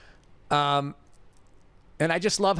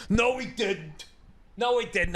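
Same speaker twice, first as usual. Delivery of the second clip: imitated curt denial, pitched up; a touch of mocking higher denial; mock flat denial; 7 semitones higher, slower than usual. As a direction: deny it flatly, twice. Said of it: "he goes".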